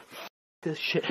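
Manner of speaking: frightened whisper. Real, not acted. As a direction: hiss it under your breath. Scared, leaving.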